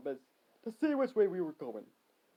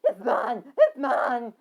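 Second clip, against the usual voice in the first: high, loud possessive wail; yelling; 6 semitones higher, 9 dB louder, much slower than usual. Acted. Harry tackling for the nickel, high yelling. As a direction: yell it over and over.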